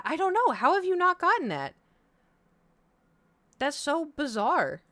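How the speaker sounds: loud, high real disbelief; high, loud astonished puzzling; baffled. Real, not acted. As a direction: exclaim in honest bewilderment. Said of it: "real puzzlement, loud and high".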